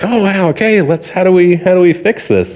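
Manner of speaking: put-on high, flustered old-person act; mock hysterical. Old person desperate to fix the mistake, mock hysterics. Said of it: "a hysterical state".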